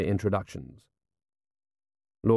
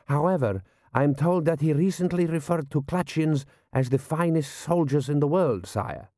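higher-pitched deferential general's voice; 5 semitones higher than usual. Acted. General briefing his prince on the enemy, deferential. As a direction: report upward in a raised character voice.

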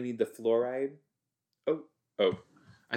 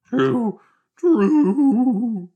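squeaky, much higher and louder cartoon dog voice; Scooby-Doo voice; 13 semitones higher, 11 dB louder than usual. Real, not acted. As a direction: yelp the word like Scooby-Doo. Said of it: "It sounds like Scooby-Doo".

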